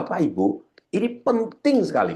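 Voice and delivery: PENTING lifted very high; penekanan intonasi. Shown as a demonstration. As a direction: make "penting" leap up in pitch and linger. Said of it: "misalnya begitu". (tekankan "penting" dengan nada sangat tinggi)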